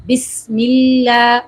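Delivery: slow "بسم اللہ" with a clear hissing s; joined Ba and Seen. Shown as a demonstration. Teacher introduces tasmiyah, slow clear-s "بسم اللہ". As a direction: stretch "بسم اللہ", sounding every letter. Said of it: "با کو سین سے ملاتے ہوئے".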